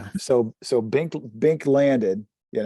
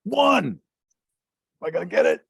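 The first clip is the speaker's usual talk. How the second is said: tense die call; 6 semitones higher, 4 dB louder, faster than usual. Real, not acted. Dice roll suspense.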